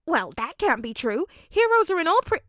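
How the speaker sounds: high, loud objection, cut off; father insisting heroes will come; protesting father. A narrator's reading.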